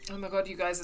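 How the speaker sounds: normal talking voice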